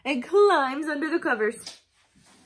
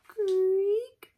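ordinary delivery

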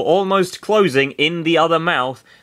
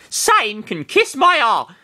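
put-on high girl voice; 10 semitones higher, slower than usual. Sarah voiced in read fiction.

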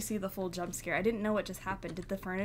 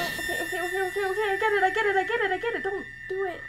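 high, loud, rapid-fire repetition; panicked babbling; 8 semitones higher, 6 dB louder, much faster than usual. Real, not acted. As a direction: plead in a rush of panic. Pleading during a scare.

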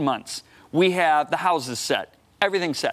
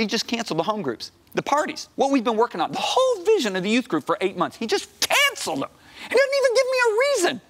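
indignant rant; 10 semitones higher than usual. Retold or imitated. Youth pastor vents to his wife about the pastor, outraged. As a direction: vent the injustice, climbing to a near-squeal.

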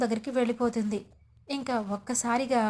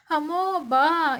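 higher, louder, slow drawn-out cry of pain; 4 semitones higher, 5 dB louder, much slower than usual. Acted. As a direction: wince out loud as her sore arm is touched. Wife cries out in pain.

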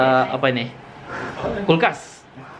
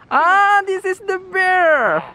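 excited anime fan; much slower than usual. Acted. Showing bear toy, high cute voice.

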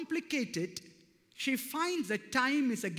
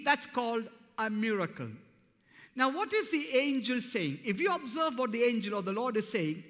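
ordinary talk

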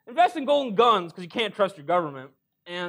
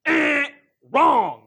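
loud; 4 semitones higher, 8 dB louder than usual. Acted. Cowboy buzzer-style correction.

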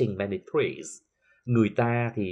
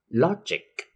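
"logic" with a high, loud LO; stress on LO; 7 semitones higher, 5 dB louder than usual. Shown as a demonstration. Teacher drilling -ic words, LO stressed in "logic". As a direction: punch the first syllable of "logic". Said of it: "sẽ được nhấn âm ngay phía trước".

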